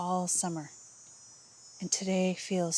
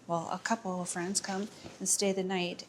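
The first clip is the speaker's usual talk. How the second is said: ordinary talk, nothing marked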